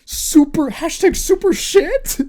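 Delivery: high, loud frustrated cry; a mock-upset hero cracking at last; exasperated outburst. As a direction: blurt it out, fed up and upset. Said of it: "And he's like".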